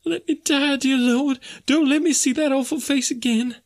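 high falsetto pleading; terrified wailing. A narrator's reading. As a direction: beg in dread with eyes shut tight. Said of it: "cried the poor woman".

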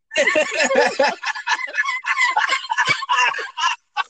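very high, loud cackling; real loud laughing fit. Real, not acted. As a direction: crack up helplessly and at length. Cracking up at the Daniel story.

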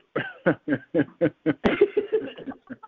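long high-pitched laugh. Real, not acted. Host who loves the dream story, laughing. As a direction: keep laughing helplessly, high. Listener cracks up.

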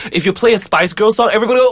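normal talking voice

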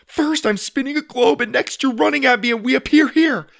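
high, loud panicked babble; frantic boy raising his voice; panicking. A narrator's reading. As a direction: let panic take over the words. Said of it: "Sawyer started to panic".

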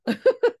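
a high burst of laughter. Real, not acted. Vlogger laughs at her own interview story, high laugh. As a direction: laugh out at the absurdity.

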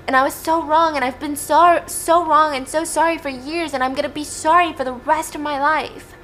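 high, pleading outpouring of remorse; impassioned apology. Acted. Tate begging Kaya's forgiveness, acted anguish. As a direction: pour out regret, urgent and high.

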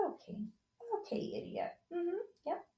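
whispered "Okay, idiot" under the breath; a dry, quiet jab aside; breathy sarcastic whisper; sarcastic mutter. Real, not acted. Sarcastic aside about son.

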